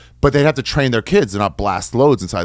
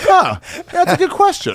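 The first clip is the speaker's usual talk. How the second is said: high, slow pondering God; comic God voice; slower than usual. Retold or imitated. God considers the slaves' request, comically pondering. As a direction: play God mulling it over, pitched way up. Acting God's reply.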